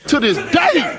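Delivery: loud hype chant; hype shout. Real, not acted. Hyped repeat.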